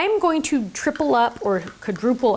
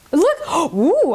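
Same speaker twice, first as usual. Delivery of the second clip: delighted gasp; 7 semitones higher, 5 dB louder than usual. Real, not acted. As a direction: gasp then a loud high ooh. Delighted by stamp result.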